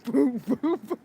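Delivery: loud, laughing replay of the line; laughing mock line. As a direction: hammer the booms while cracking up. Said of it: "That's my favorite line in the whole movie".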